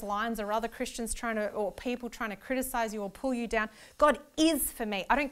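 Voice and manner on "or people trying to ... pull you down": ordinary talk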